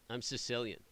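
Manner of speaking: ordinary talk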